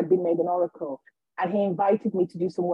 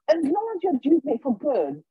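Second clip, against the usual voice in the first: higher-pitched approving vicar imitation; happy vicar; 6 semitones higher than usual. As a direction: approve with delighted eagerness. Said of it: "so happy for me".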